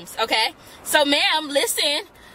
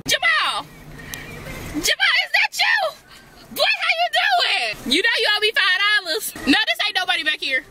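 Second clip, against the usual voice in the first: high excited shout across the lot; calling out; 12 semitones higher than usual. Acted. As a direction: yell out to a buddy, pitched way up.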